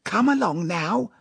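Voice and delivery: excited urging. A narrator's reading. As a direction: hurry her along excitedly. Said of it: "pale with excitement".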